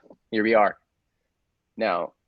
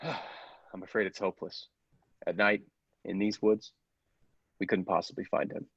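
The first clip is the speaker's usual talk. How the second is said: sighing, much softer and slow; resigned sigh; 7 dB quieter than usual. Acted. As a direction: give up with a heavy sigh. Resigned after the killer escapes.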